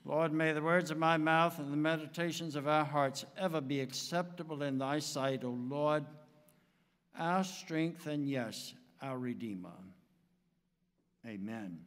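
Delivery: soft, low prayerful voice. Acted. Pastor opening the sermon in prayer, hushed and reverent. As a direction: pray it quietly and reverently.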